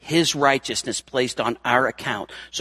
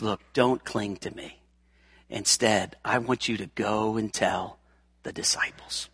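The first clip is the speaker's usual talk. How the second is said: gentle hushed Jesus; 5 semitones lower, 8 dB quieter than usual. Acted. Jesus gently sends Mary.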